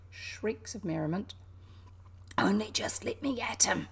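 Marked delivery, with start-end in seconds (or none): 2.4-3.9 whispered through gritted teeth; seething teeth-grinding whisper; angry mutter. A narrator's reading. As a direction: mutter it with held-back fury. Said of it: "muttered Toad, grinding his teeth".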